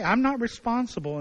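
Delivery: defensive denial. Acted. Eve denying blame before God, high and defensive. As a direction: protest your innocence a little too hard.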